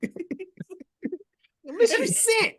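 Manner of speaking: high, very slow, laughing through; hammed-up sobbing whine. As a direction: drag it out like fake crying. Champ quote echoed.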